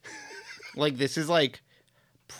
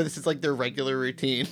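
ordinary talk

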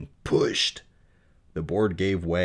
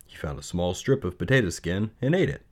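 ordinary delivery

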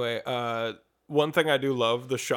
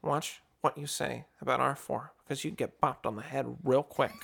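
George Lucas voice; 2 semitones lower, 6 dB quieter than usual. Retold or imitated. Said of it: "George Lucas looks at him and goes".

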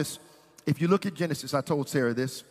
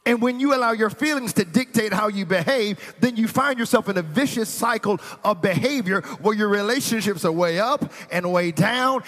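high, loud, driving sermon voice; full-force preaching; 5 semitones higher, 4 dB louder than usual. Acted. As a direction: preach it hard to the congregation.

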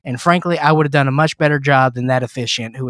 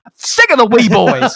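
fed-up outburst; 8 semitones higher, 6 dB louder than usual. Real, not acted. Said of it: "fed-up outburst".

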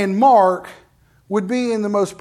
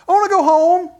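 put-on high, loud little-kid whine; childish whining voice; whiny child; 7 semitones higher, 5 dB louder than usual. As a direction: whine like an eight-year-old begging to go home.

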